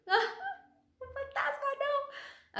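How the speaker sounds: very high squeal broken by laughter; laughing squeal. Acted. Preacher mimicking scared disciples, laughing squeal.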